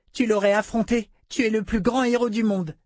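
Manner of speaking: put-on high, eager little girl's voice; a delighted small child gushing; child voice full of admiration; little girl Rachel.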